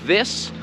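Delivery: "This" with tongue out, voiced th; correct th. Shown as a demonstration. Teacher repeating the right sound. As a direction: model "This" clearly. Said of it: "stick out your tongue".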